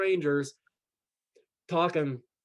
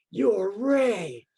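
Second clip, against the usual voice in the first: higher-pitched retold exclamation; startled recognition; 5 semitones higher, 4 dB louder than usual. Coworker recognizing the man from Facebook, startled. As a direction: act out suddenly realizing who this is.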